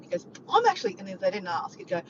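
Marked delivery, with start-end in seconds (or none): 0.5-1.0 high, loud, eager retold boast; mock-casual brag bursting with excitement; excited friend. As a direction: imitate a friend excitedly announcing plans. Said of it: "he was so excited".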